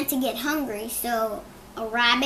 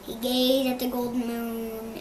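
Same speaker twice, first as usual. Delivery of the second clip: ordinary delivery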